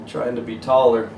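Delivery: ordinary delivery, nothing marked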